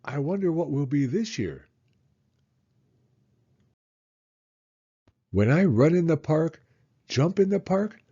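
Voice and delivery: put-on a small boy remembering, full of wonder; child's voice, bright with memory; eager little boy. Child voice reading son's lines.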